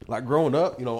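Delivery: normal talking voice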